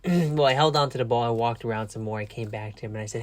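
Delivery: normal talking voice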